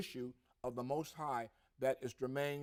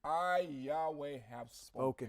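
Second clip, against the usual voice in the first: I, Yahweh, drawn out slow and solemn; slow solemn declaration. Shown as a demonstration. Preacher intoning God's words to his co-host, slow and solemn. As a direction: lean on I, slowly declaring Yahweh. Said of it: "Spoken it".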